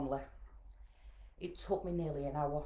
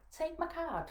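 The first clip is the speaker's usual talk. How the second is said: higher-pitched imitation of the nurse; acted nurse's request; 12 semitones higher, slower than usual. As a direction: offer it firmly without raising the volume. Nurse first offers her card.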